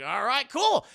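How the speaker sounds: high, loud, pleased exclamation; delighted Goofy. Retold or imitated. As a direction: rise happily on cool. Goofy delighted by her yes.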